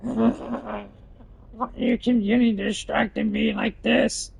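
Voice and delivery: high mock voice; high character voice. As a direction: tease in a squeaky voice. High voice teasing.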